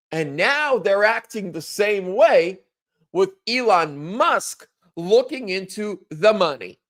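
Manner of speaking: ordinary talk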